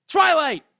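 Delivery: loud, high, sharp call of the name; sharp snap. Acted. Celestia silencing her pleading pupil with a snap. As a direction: cut her off hard. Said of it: "Celestia snapped".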